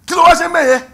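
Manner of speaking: high, loud shocked question; Holy Spirit voiced. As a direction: shriek the question as if scandalised. Holy Spirit voiced asking in Yoruba.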